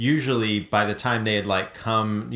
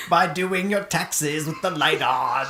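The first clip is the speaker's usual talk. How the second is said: spooky voice; 5 semitones higher than usual. Acted. Spooky persona joke.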